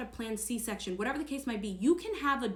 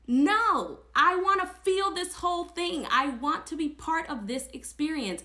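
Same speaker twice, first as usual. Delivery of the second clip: retold firm no, higher and punched; emphatic refusal; 7 semitones higher, 4 dB louder than usual. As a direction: insist on it, pitched up, replaying the refusal. Retelling her firm refusal to nurse.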